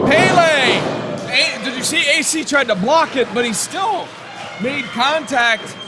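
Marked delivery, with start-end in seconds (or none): 0.1-2.7 loud, high burst of hype; excited shout. Real, not acted. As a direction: yell the move name. Kick lands, commentator erupts.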